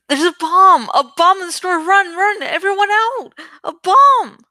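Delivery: high, loud, rising alarm shouts; panicked screaming. A narrator's reading. Narrator screams at the crowd to run, loud alarm. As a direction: scream to empty the store in panic. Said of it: "I screamed at the top of my lungs".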